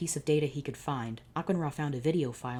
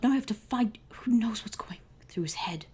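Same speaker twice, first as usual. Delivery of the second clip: higher, worried whisper; 8 semitones higher than usual. Acted. Pharaoh fearing for the boy, whispered in worry. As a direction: whisper it in dread.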